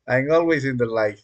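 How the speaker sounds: ordinary talk, nothing marked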